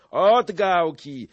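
high, loud, drawn-out cry; rowdy battle shout. A narrator's reading. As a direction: shout it out slowly and defiantly. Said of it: "krzyknął".

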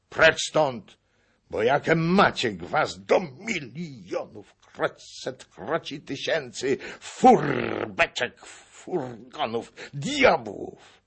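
loud, high, explosive outburst; angry dismissal in bursts; furious cursing. A narrator's reading. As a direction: spit out the curse piece by piece. Old man curses and throws them out.

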